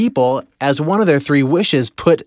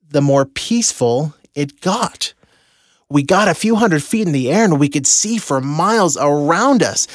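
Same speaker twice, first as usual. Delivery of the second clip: ordinary talk, nothing marked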